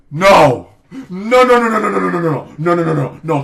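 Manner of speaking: loud, frantic string of shouted no's. Real, not acted. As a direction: shout them down, over and over. Panicked no to assassination calls.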